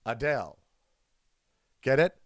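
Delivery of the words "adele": Adele said as uh-DALE; corrected pronunciation. Shown as a demonstration. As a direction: say Adele rhyming with pale. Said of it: "Just think of uh and [name]".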